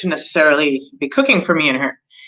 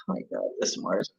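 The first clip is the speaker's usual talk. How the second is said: much lower, hushed exasperated mutter; exasperated sigh; 11 semitones lower, 11 dB quieter than usual. Retold or imitated. Fed-up astrologer blaming Mars for her morning, exasperated hushed sigh. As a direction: sigh it out under your breath, fed up.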